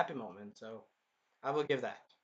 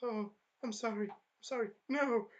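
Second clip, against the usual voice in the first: mock panicked begging; 5 semitones higher, 3 dB quieter than usual. Spear hero begging, rising to shout.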